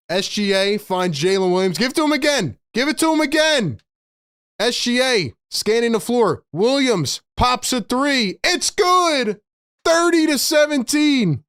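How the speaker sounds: high-pitched, thrilled basketball call; excited play-by-play. Real, not acted. Streamer calls made threes for viewers, excited play-by-play.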